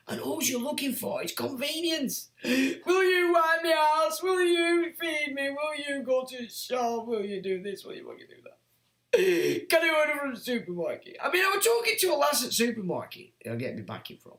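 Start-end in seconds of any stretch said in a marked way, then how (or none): 2.4-11.2 a needy adult begging to be waited on; mock whiny pleading. Mocking lazy people asking favours.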